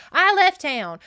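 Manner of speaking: loud, high, emphatic comeback; acted outraged contradiction, loud and high; indignant rebuttal.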